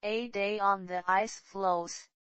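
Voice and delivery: plain reading voice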